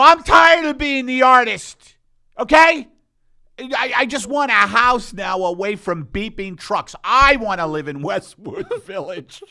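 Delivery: loud, high exasperated outburst; comic ranting. Acted. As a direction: shout it like an artist at the end of his rope. Comic rant about selling out.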